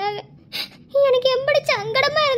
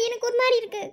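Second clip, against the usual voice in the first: usual speaking voice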